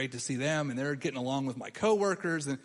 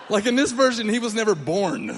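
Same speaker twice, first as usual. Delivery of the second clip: loud, higher shouted joke through laughter; laughing shout; 4 semitones higher, 7 dB louder than usual. Real, not acted. Laughing to the audience about erasing his friend, shouted. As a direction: yell the punchline while cracking up.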